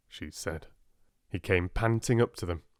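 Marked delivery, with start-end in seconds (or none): none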